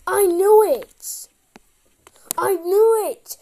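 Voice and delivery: loud, slow and triumphant; gloat, drawing it out. Real, not acted. Solves puzzle triumphantly.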